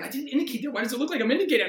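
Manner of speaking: high-pitched, exasperated protest; retold self-annoyance. Mimics own annoyance watching himself.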